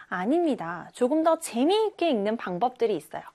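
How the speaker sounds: ordinary talk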